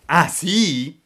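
acuto e forte, sfida rabbiosa; grido arrabbiato. A narrator's reading. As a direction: sbotta furioso. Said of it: "gridò Pinocchio ... collera". (Pinocchio accecato dalla collera, grida forte)

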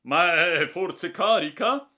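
slow, loud, high alarmed question; alarmed exclamation. Acted. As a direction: gasp it out, eyes wide. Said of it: "aveva esclamato". (esclamazione allarmata, lenta e forte)

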